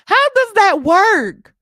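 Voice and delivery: incredulous question. Real, not acted. Streamer asking viewers, slow and incredulous. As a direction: ask it slowly, stunned.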